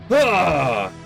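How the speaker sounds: high, falling villainous cry; dramatic cry sliding down in pitch; high exclaimed "ah". Acted. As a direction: let out a theatrical, falling "ah". Villain Rudolph carries out threat.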